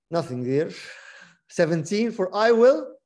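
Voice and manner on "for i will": "I will" slow and louder; stress on I will. Shown as a demonstration. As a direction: hit "I will" so they can count it. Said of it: "How many "I will" can you count".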